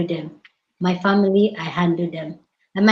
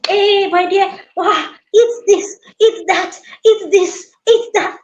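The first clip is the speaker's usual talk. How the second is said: much higher and louder, pressing food; excited doting urging; 11 semitones higher, 4 dB louder, slower than usual. Retold or imitated. Mother-in-law urges her visiting daughter-in-law to eat, high and loud.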